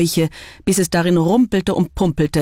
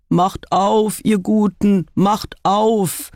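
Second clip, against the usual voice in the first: männliche Stimme; 4 semitones higher, much slower than usual. A narrator's reading. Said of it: "erscholl eine männliche Stimme".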